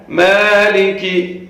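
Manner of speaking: slow مالکی with lengthened first vowel; long maa. Shown as a demonstration. Teacher drawing out مالکی for students. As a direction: stretch the maa in مالکی, kaf quick. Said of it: "کاف کو جلدی پڑھنا ہے".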